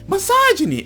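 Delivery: high, loud mock shriek; hammed-up accusing yell; mock scream. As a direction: imitate her screaming the word. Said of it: "She's gonna scream".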